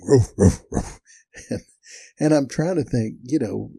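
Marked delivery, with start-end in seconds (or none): 0.0-1.0 loud imitated woofs; dog barking. Storyteller imitates his dog barking into the well, loud woofs. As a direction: bark like an excited dog. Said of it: "sticking his head down in the well and barking".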